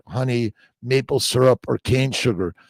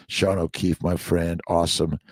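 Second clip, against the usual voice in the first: normal talking voice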